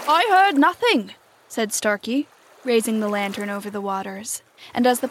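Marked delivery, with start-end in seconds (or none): 0.1-1.1 higher, louder pirate reply; voiced pirate. A narrator's reading. Starkey answers the startled captain, high and loud. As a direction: answer loudly in a raised pitch.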